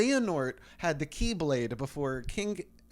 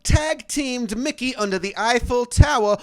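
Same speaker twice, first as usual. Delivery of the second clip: high, loud, slow proclamation; street preaching; 8 semitones higher, 13 dB louder, slower than usual. Pretend. Shouting lore on the street like a preacher. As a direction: bellow it like a prophet on the corner.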